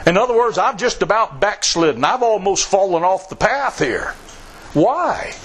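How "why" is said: ordinary talk, nothing marked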